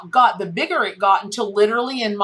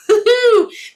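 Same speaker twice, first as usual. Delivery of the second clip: bright high laugh; quick high laugh after the card; high burst of laughter; 13 semitones higher, 6 dB louder than usual. Real, not acted. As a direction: laugh out loud, high and sudden.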